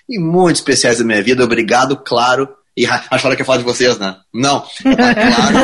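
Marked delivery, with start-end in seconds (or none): 4.8-5.6 high laughing punchline. Real, not acted. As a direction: laugh the words out.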